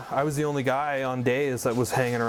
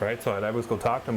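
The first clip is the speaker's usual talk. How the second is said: ordinary delivery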